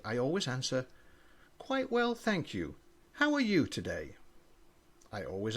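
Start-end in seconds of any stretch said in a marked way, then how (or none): 1.6-4.2 high, bright-voiced child reply; a child's sing-song politeness; eager polite child. A narrator's reading. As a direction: chirp it like an eager little child.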